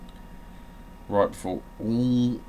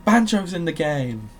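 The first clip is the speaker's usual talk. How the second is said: higher, louder cheering voice; crowd cheers; 8 semitones higher, 8 dB louder than usual. Acted. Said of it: "Instead came the cheers".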